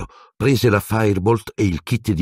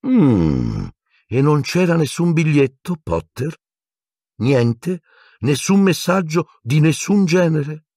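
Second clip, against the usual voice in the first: high probing stern teacher; suspicious professor pressing for answers; stern questioning; 11 semitones higher than usual. A narrator's reading. McGonagall questioning Harry about the missing note.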